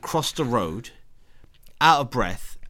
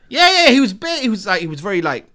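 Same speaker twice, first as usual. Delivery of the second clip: excited; 11 semitones higher, 10 dB louder than usual. Real, not acted. Excited retelling.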